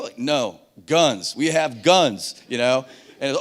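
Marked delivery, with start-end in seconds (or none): 0.2-2.3 drawn-out words, leaning on guns; slow and deliberate radio correction; slow, emphatic explaining. Retold or imitated. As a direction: make it simple for a foreigner. Explaining to confused French listener.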